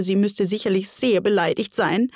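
plain reading voice

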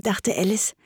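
ordinary delivery

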